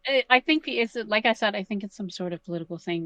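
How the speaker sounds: normal talking voice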